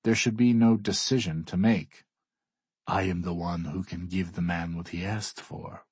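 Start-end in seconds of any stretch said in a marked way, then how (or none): 2.9-5.9 a hint of a cold, quiet Russian; Alexei's voice. A narrator's reading. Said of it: "Alexei tells him".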